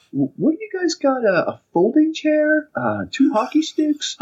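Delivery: very high, slow mocking list; incredulous cop. Cop listing the weapons in disbelief, very high and slow. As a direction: sound baffled at each item. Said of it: "He's like".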